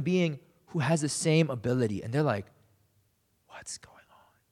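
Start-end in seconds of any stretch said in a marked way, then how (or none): 3.5-4.4 whispered in disbelief; stunned whisper. Retold or imitated. Disciples stunned by Jesus calming the sea, whispering. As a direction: breathe it out, no voice.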